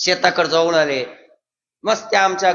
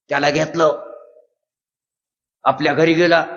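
ordinary delivery

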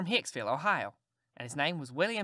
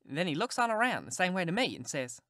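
plain reading voice

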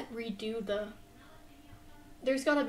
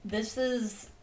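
ordinary talk

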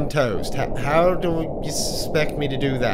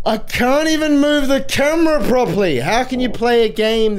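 high, loud exasperated complaint; frustrated rant; 8 semitones higher, 5 dB louder than usual. Real, not acted. Player fighting the game camera, frustrated outburst. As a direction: burst out loudly in frustration.

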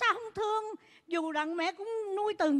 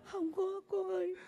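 mock crying; 8 dB quieter than usual. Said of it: "khóc ở đây".

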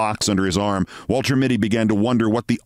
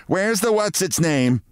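high, slow, pointed question; nagging wife; 10 semitones higher than usual. Retold or imitated. Wife scolding Mitty for forgetting the errand. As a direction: needle him about the forgotten item. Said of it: "she would ask".

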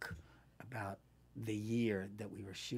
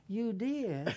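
high-pitched Southern question; Southern accent; 9 semitones higher than usual. Retold or imitated. Co-star replying to actress about the book, Southern accent. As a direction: imitate a co-star's Southern accent. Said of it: "he started talking with the Southern".

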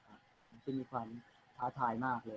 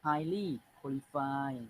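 slow dictation reading; much slower than usual. Shown as a demonstration. Teacher reads text aloud.

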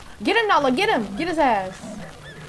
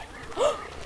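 sharp gasp; 6 dB quieter than usual. Real, not acted. Sudden in-game danger.

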